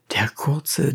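usual speaking voice

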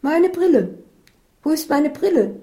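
high, loud shrieking complaint; panicked cry. A narrator's reading. Isabel has lost her glasses, high loud cry. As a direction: cry out in high-pitched alarm. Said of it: "schrie Isabel".

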